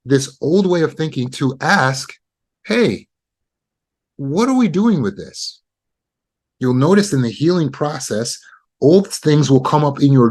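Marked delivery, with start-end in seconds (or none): 2.7-5.6 higher, curious voice of the inner mind; the subconscious mind nudging the dreamer with a lightly voiced question. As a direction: ask it as your own subconscious, gently puzzled.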